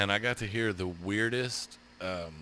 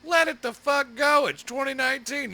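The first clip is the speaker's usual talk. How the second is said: yelling back; 9 dB louder than usual. Retold or imitated. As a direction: yell it across the street at a neighbor. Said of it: "we're just all yelling at each other".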